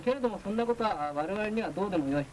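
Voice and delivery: ordinary talk, nothing marked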